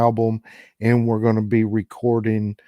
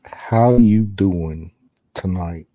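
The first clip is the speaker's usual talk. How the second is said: lower, very slow drawn-out flirt; Joey-style pickup line; 4 semitones lower, slower than usual. Pretend. Ghost hunter hamming it up for the spirits, lower and very slow. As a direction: stretch it out like a smooth pickup line. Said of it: "he's getting a sexy voice".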